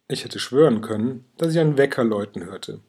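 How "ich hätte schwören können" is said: ordinary delivery, nothing marked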